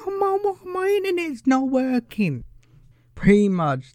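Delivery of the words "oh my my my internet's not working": high, put-on whine about the Wi-Fi; mock the panicked teenager in a high voice; whiny phone addict. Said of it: "they're all going".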